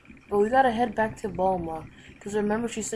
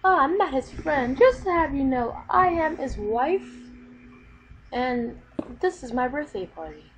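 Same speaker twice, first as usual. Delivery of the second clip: higher and louder, slowing down on "wife"; indignant Bulma voice; 5 semitones higher, 4 dB louder than usual. Acted. Bulma telling the guest she is Vegeta's wife, indignant. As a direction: set him straight, proud and huffy.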